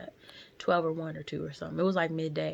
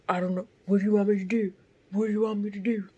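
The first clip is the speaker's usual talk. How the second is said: loud, raised-pitch helpless protest; mocking imitation; 4 semitones higher, 8 dB louder, much faster than usual. Driver brushing off the woman he hit, loud mocking imitation. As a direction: throw up an exasperated defence, loudly. Mimics driver, calls him asshole.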